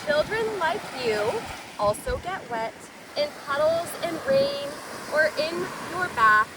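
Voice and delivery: plain reading voice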